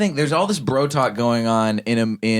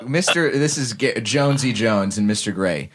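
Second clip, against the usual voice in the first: usual speaking voice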